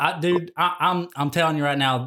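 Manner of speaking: ordinary talk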